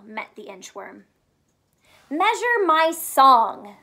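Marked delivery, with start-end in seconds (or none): 2.1-3.8 slow, louder, lifted sing-song request; a proud bird asking grandly, drawn out; nightingale's request. Acted. Nightingale asking the inchworm, slow and grand. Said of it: "said the nightingale".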